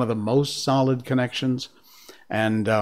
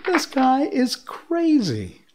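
amazed; 11 semitones higher, slower than usual. Real, not acted. Amazed by the courier.

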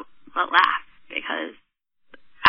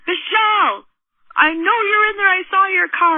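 high, loud call through the door; imitated angry shouting; retold yelling; 10 semitones higher, 4 dB louder than usual. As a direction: holler as the insistent stranger outside. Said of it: "yelling at me and screaming through the window".

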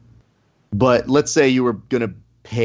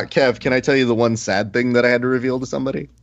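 usual speaking voice

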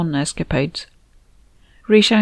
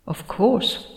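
said dryly; 3 semitones higher, 5 dB quieter than usual. Acted. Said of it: "Stavros said dryly".

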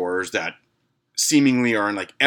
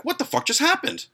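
baffled. Retold or imitated. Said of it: "I was like".

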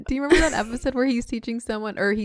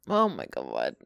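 disgusted. Real, not acted. Disgust at rotten food.

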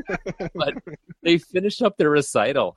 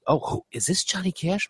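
higher, lightly voiced older lady asking; curious mother-in-law; 7 semitones higher, 4 dB quieter than usual. Mother-in-law hearing [name] for the first time, lightly voiced and curious. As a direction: ask it like a sweet curious grandma.

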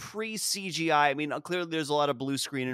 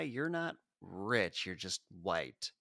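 ordinary talk

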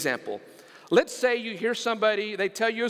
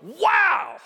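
loud, high shout of wonder; awestruck wow; 11 semitones higher, 6 dB louder than usual. Acted. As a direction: let the wow burst out, high and big. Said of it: "moves me to go".

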